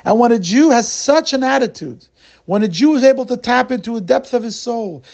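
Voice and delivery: rising fervent appeal; impassioned preaching. Acted. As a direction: preach the lesson with fire. Preacher lesson after story.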